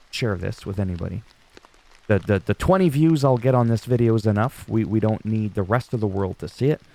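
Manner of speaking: ordinary delivery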